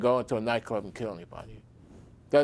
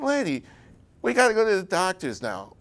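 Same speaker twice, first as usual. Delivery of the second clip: exasperated retort; 7 semitones higher than usual. Retold or imitated. Son snaps at woman in taxi.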